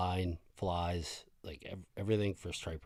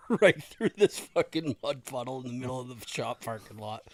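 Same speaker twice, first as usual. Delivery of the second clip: laughing; 7 semitones higher, 7 dB louder than usual. Real, not acted. Laughing while telling.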